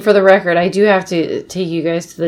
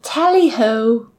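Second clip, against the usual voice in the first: high, drawn-out mock British farewell; exaggerated British cheerio, very slow; put-on British accent; 9 semitones higher, 3 dB louder, slower than usual. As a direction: sing out a hammed-up British goodbye, building louder. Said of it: "Give me a British accent".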